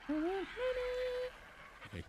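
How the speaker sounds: very high, soft, drawn-out okay; a tiny frightened squeak; squeaky okay. Real, not acted. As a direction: squeak it out nervously. Nervous about getting off.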